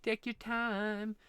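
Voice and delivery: soft and slow, coaxing the sister along; softly reassuring sister while she draws; gentle encouragement. Pretend. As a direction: hush it out like a patient cheerleader.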